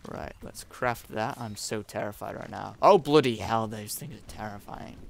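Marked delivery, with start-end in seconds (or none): 2.8-3.7 sudden loud gasp of fright; startled loud outburst. Real, not acted. Gamer spotting scary dinos, startled loud swear. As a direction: jump at the sight and swear.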